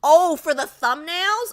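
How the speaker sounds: sudden realization; surprised. Real, not acted.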